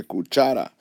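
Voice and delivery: "cuchara" stressed on cha; stress on middle syllable. Shown as a demonstration. Teacher showing the normal stress, "cuchara" accented on cha. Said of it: "My voice normally goes up".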